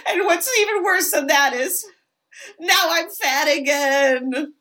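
high mock wail, slowing at the end; tearful confession. [name] admits his regained weight, mock wailing. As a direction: wail it with hammed-up misery.

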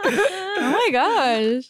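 laughing, drawn out. Real, not acted. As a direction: laugh it out slowly.

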